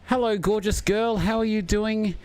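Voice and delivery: dad coaxing his little daughter onto the stream, high and sing-song. Real, not acted.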